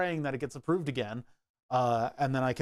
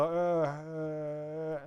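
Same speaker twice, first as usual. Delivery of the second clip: idle drone; 3 dB quieter than usual. Retold or imitated. Printer owner acting out waiting for a print, vacant drone. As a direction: drone vacantly on one held note. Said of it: "I just kinda sit there and I go".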